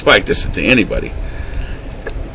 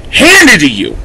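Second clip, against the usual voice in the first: handed to you, repeated in rising anger; louder, higher echo; 7 semitones higher, 4 dB louder than usual. Real, not acted. Angry echo of own phrase.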